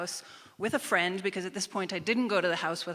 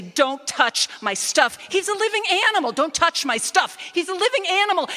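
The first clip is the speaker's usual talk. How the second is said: much higher, much louder furious repeating; raging repetition; 11 semitones higher, 9 dB louder than usual. Retold or imitated. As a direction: hammer the words in fury. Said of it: "in a rage".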